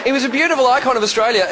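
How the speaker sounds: ordinary delivery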